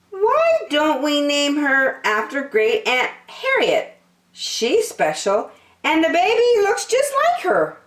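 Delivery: put-on high, bright childlike suggestion; proud of his idea; hopeful young turtle. Franklin suggesting the name to parents, bright childlike.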